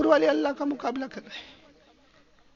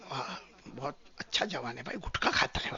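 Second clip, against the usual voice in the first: hushed unvoiced aside, softer; whisper; 7 semitones lower, 12 dB quieter than usual. Bystanders whispering praise of the gutka-chewing youth. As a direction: whisper like gossiping onlookers. Onlookers admiring gutka eater.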